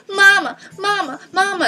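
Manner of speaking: high, loud whining mama; mock child whining for mama; whiny child. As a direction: whine like a bratty kid. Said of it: "it's whining, and going".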